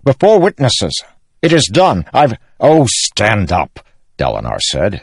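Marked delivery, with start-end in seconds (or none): 0.0-2.3 very high, loud declaration; heated, raised royal protest; flustered insistence. A narrator's reading. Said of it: "growing red-faced". 2.6-3.8 drawn-out, louder, impatient command; slow, fed-up uncle; exasperated order. Acted. Uncle orders kneeling king to rise.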